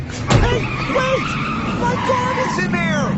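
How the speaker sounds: panicked crying out. Acted. A man watching his house taken cries out, slow panicked shout. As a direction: cry out as everything is taken.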